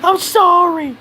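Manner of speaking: squeaky high strangled apology; choked bully apologizing. Retold or imitated. Pinned bully begging the narrator to let go, in a squeaky high whine. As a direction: squeak out a desperate sorry. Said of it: "he's like".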